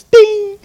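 very high bright bell sound, sung out; imitated heaven bell ding. Shown as a demonstration. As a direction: ring it out like a bell going off in heaven. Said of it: "watch, it goes like this".

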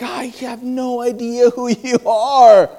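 puzzled, slow, laughing through the words; slow, baffled, with a laugh; laughing confusion. Retold or imitated. Caller laughs at an unknown voice, slow and puzzled.

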